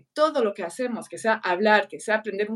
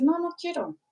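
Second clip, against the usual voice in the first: put-on high-pitched small child's refusal; niña que se niega; 7 semitones higher, 3 dB quieter than usual. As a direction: imitate a little girl refusing, voice pitched up. Hija pequeña se niega. (niña pequeña negándose, con voz aguda)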